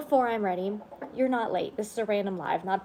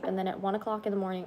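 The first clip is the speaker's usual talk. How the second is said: ordinary talk, nothing marked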